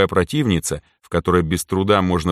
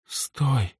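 barely audible, weak; dying girl's faint plea; faint whisper; 6 semitones higher, 6 dB quieter than usual. A narrator's reading. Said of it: "её еле слышный шёпот". (еле слышный шёпот)

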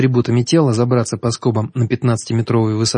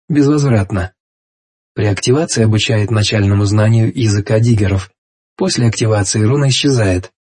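reading out a description. A narrator's reading. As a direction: read it like an item card. Reads rune description.

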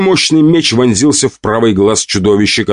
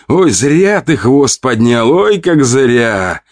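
taunting; 4 semitones higher, much slower than usual. Acted. Taunting the monster.

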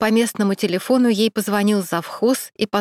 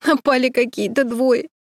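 put-on higher, tearful sob of a girl; sobbing; 5 semitones higher than usual. A narrator's reading. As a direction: blurt it out through tears. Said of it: "всхлипнула Саша". (со всхлипом, выше обычного)

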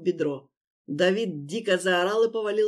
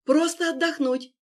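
nervous; 8 semitones higher, 3 dB louder than usual. Acted. Victim lies nervously.

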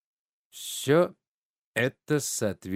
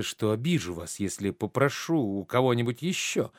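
ordinary talk, nothing marked

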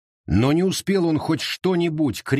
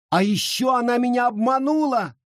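high wailing cry; wailing accusation; 12 semitones higher than usual. A narrator's reading. Charity wails to the sheriff, high and accusing. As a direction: wail the accusation out. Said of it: "заголосила Черити".

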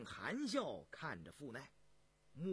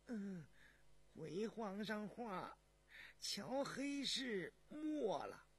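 high, slow, hesitant palace servant; high-pitched deferential voice; servant breaking bad news; 5 semitones higher, much slower than usual. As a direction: report timidly to the emperor, drawn out. Palace servant reports a death.

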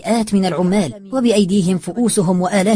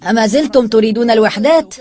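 raised, projected voice; loud taunting question; 5 semitones higher, 7 dB louder than usual. A narrator's reading. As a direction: challenge them loudly. Taunting the beaten gang.

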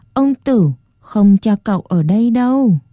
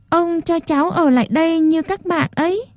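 put-on higher, softer little boy voice; small pleading boy; 7 semitones higher, 5 dB quieter than usual. A narrator's reading. Puppet boy asks the old man to let him stay, softly pleading. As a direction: ask timidly like a small child.

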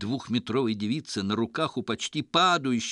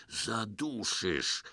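half-choked gasp; choking; 6 dB quieter, much slower than usual. A narrator's reading. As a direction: squeeze it out like you're choking. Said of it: "едва не поперхнулся бородой".

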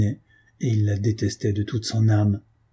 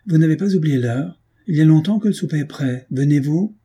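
calling out; 6 semitones higher, 4 dB louder than usual. A narrator's reading. Matty calls from outside.

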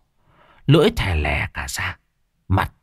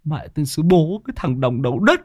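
angry cursing; 10 semitones higher than usual. Acted. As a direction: spit the curse out at the fool. Character cursing in a rage. (chửi rủa giận dữ, cao và to)

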